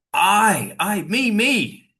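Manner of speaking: "I" and "me" punched high and drawn out; high, emphatic "me"; insistent stress on I and me. Shown as a demonstration. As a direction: hammer each "I" and "me" to single yourself out. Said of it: "is the one that loves you".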